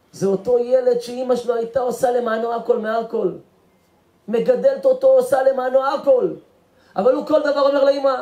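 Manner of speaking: high, loud preacher's lament; impassioned preaching. Acted. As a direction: drive it home from the pulpit. Preaches at full force.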